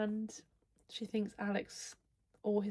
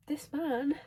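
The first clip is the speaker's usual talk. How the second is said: excited; 6 semitones higher, 4 dB louder than usual. Real, not acted. Swooning over fictional love interest.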